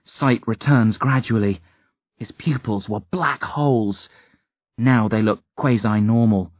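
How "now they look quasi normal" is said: ordinary delivery, nothing marked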